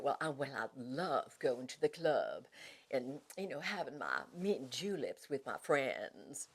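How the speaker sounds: slow Southern society lady accent; Southern accent of a lady who lunches, slowed down. Shown as a demonstration. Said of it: "the Southerners that I did".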